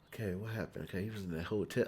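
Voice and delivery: soft, low self-talk; muttering to herself. Retold or imitated. Walking into the movie unsure of the plot, soft self-talk. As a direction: mumble it as if catching up alone.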